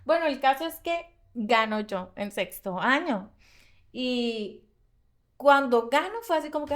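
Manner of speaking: ordinary delivery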